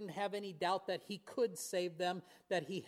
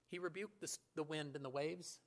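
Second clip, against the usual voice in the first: ordinary talk, nothing marked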